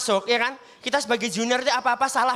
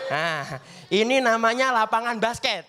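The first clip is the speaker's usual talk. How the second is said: slow, drawn-out guide voice; slow tour guide; slower than usual. Acted. Senior showing new students the school, slow guiding voice. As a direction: spell out each word for the new kids.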